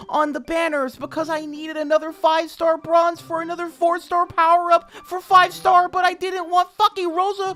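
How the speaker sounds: very high, loud furious whine; mock P L screaming at the devs; mocking ranting gamer. Narrator reading P L's rant at Dina, mock high furious whine.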